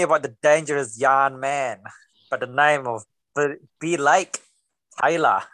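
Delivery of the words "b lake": normal talking voice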